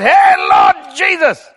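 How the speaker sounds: loud, high, slow shout; bold prayer shout. Shown as a demonstration. Preacher showing his courageous prayer, slow loud shout. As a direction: call out to the Lord boldly.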